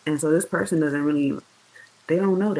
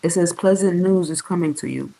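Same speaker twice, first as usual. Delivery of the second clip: ordinary talk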